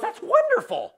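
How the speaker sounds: high, gushing, overdone praise; mock-delighted gatekeeper; Saint Peter's gushing praise. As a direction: lay the praise on thick, way up high. Saint Peter answers the man.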